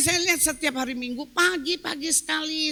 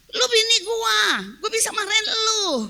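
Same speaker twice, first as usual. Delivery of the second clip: high, loud husband's declaration; acted indignant assertion; 8 semitones higher, 6 dB louder than usual. Husband telling his wife he may scold her, indignant. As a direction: imitate a husband insisting on his rights.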